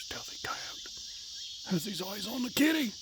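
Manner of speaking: breathless stalking commentary; soft mock nature-show hush; hushed documentary whisper. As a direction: whisper it like a wildlife narrator.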